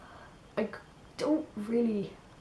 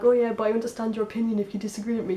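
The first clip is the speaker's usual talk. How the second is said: mock rambling; 2 semitones higher, 6 dB louder, faster than usual. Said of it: "I can't ramble on for ages".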